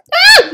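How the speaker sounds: short, piercingly high scream; very loud scream of alarm; panicked scream. Real, not acted. Player panicking in the game, short loud scream. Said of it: "So I'm screaming, guys".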